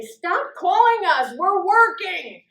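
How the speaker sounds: slow, loud and high, hammed-up captain; captain's yell. The captain yelling at people calling from the towers, loud and slow. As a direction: bark it out like an annoyed captain. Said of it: "the captain would go down and say".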